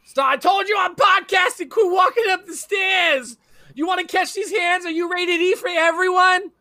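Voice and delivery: mock yelling at kids. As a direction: play an exasperated parent shouting up the stairs. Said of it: "Just, like, yelling at my children".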